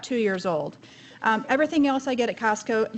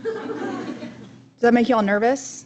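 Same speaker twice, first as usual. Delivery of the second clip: slow teasing question; much slower than usual. Real, not acted. Teasing the audience.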